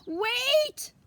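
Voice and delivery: high, loud cry of alarm. Acted. Grandmother stopping the girl's swing, loud high cry. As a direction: call out sharply to stop her. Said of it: "cried Rosa Maria".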